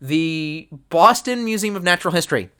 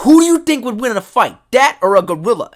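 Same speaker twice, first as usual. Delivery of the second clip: loud, higher Boston bro challenge; Boston accent; 7 semitones higher, 10 dB louder than usual. Retold or imitated. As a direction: ask it like a brash Boston dude. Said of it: "traditional Boston accent".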